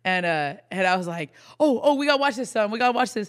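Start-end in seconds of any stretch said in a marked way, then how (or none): 1.6-3.3 loud, high, urgent excited outburst; excited yelling. Retold or imitated. Parent to baby during the game, excited yelling. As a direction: call out excitedly, voice jumping up. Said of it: "what I was yelling about".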